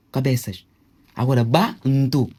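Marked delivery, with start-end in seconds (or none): none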